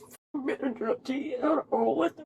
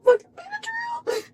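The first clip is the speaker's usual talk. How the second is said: high tearful wail; crying. Real, not acted. Alone in the car after the arrest, crying. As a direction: choke the word out through real tears.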